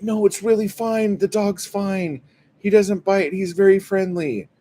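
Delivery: very high, insistent impersonation of an oblivious owner; mock reassurance. Dog owner dragged down the sidewalk, still insisting, high mock reassurance. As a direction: squeal out reassurances in a mocking high voice.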